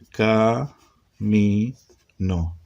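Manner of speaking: Ca, mi, no held separately, short pauses, dropping on no; syllable by syllable. Shown as a demonstration. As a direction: sound out Ca, mi, no in three beats. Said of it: "Y camino". (Ca, mi, no en tres sílabas separadas)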